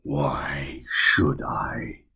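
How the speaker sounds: drawn-out, unhurried and smug; very slow smug reply. Acted. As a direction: savor every word as if amused. Sinister man replies.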